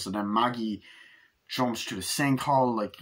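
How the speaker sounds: usual speaking voice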